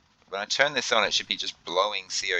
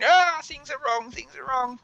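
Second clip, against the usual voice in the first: much higher squeaky panicked chatter; confused little monkey. Retold or imitated. Mocking himself as a panicking little monkey, high squeaky squeal. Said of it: "like a confused little monkey running around going".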